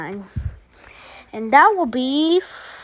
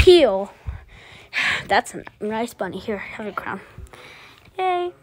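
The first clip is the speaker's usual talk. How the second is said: normal talking voice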